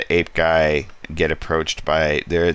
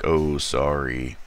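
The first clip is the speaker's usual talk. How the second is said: slow, lightly voiced meek apology; a sheepish ape guy, slow; 2 semitones lower, slower than usual. Ape guy apologizing to Moonflower, slow meek voice. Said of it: "he's like".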